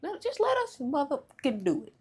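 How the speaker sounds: high, loud insistent shout. Pretend. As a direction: push it in a squeaky yell. Playful insistence to listeners.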